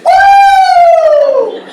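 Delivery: long, very high loud whoop; joyful whoop. Retold or imitated. New convert overcome in the library, whooping out loud. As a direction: let out a piercing, sustained woo. Acts out his joyful outburst.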